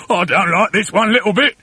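fretful husband's cry; Mister Twit crying out. A narrator's reading. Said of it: "cried Mister Twit".

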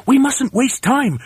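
high, slow emphatic cry; high-pitched urgent call. A narrator's reading. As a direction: cry out to rally the troops. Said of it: "cried Mugglewump".

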